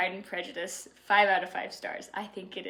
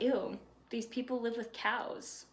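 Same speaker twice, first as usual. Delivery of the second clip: slow, softer snooty sneer; snobby disgust; 6 dB quieter, slower than usual. Retold or imitated. Rich snobs sneering at country people, disgusted. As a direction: wrinkle your nose at them.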